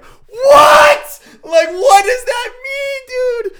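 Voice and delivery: high, loud crying shout; overwhelmed cry. Retold or imitated. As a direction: cry out in stunned disbelief. Overwhelmed by God's reply.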